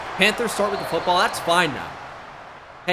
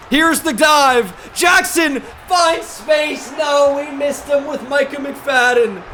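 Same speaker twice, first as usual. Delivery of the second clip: higher, louder, dragged-out call; slowed excited shout; 8 semitones higher, 6 dB louder, slower than usual. Real, not acted. Gamer calling the opponent's run live, slow excited shout. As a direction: stretch the words as the runner breaks free.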